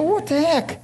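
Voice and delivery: put-on high indignant yelp of a kid; high-pitched what the heck; kid's mock outrage. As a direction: play the cheated little brother, pitch up high. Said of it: "I go".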